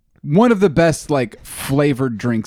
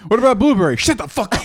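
high laughing fake boss; mock boss voice; 9 semitones higher, faster than usual. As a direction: snap it like a cranky boss. Acting angry boss, laughing.

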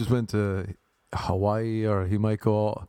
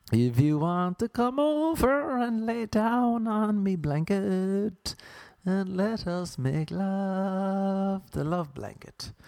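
seductive chat-up; 6 semitones higher, slower than usual. Pretend. Chat-up line again.

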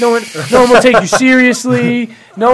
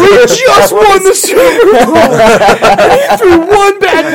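laughing exasperated rant; 12 semitones higher, 8 dB louder, much slower than usual. Retold or imitated. Exasperated Philly fan quote.

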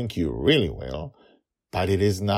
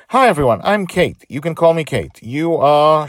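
high, loud, chirpy woman character; high-pitched, effusive hello; bubbly introduction; 9 semitones higher, 10 dB louder than usual. Acted. Kate introduces herself in the story.

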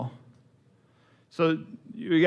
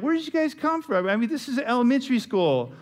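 much higher, incredulous mock voice; mock surprise; 9 semitones higher than usual. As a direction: squeak out the disbelief. Mock surprise at high schoolers.

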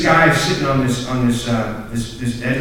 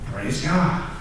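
mock relief; 3 semitones higher, 5 dB quieter, much slower than usual. Retold relief after tense moment.

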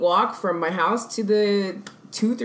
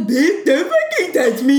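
louder, higher, drawn-out mocking mimicry; mocking echo; 4 semitones higher, 6 dB louder than usual. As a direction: throw it back as a jeering imitation, half laughing. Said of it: "she looks at me and she goes".

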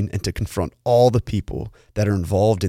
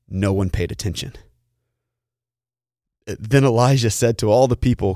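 normal talking voice

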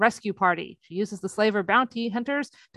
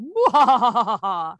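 villain laugh; 4 dB louder than usual. Retold or imitated. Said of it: "full villain mode".

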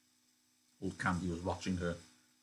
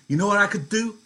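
much higher, much louder menace; wife menacing the cowering Crooks; shrill threat; 13 semitones higher, 13 dB louder than usual. Acted. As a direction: lean in and threaten hard.

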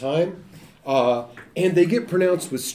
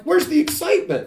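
mock excitement; 14 semitones higher, 4 dB louder than usual. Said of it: "This is a stoic response".

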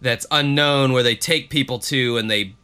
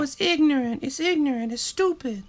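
high, soft, breathy pop-star voice; 11 semitones higher, 5 dB quieter than usual. Retold or imitated.

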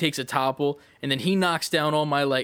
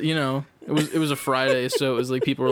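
ordinary talk

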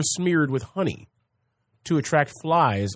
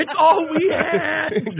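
mock sob; 13 semitones higher, much slower than usual. Waffle House lamenting, mock.

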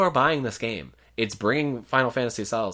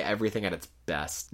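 normal talking voice

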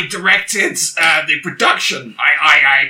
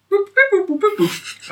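phone dialing beeps. Acted.

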